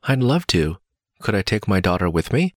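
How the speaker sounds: ordinary delivery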